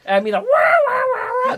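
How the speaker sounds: mocking a ridiculous cry of wah wah; mock wailing wah. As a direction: belt out a silly wailing wah. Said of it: "The first time I heard that".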